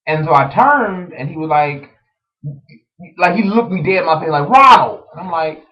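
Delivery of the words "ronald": high, loud shout; yelling a name. Retold or imitated. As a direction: holler it right at someone. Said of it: "he's yelling Ronald's name".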